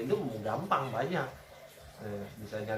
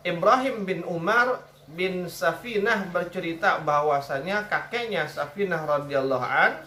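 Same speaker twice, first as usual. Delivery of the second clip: hadith reading; 2 semitones higher, 4 dB louder than usual. Acted. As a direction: read the chain of narrators deliberately. Reads hadith aloud.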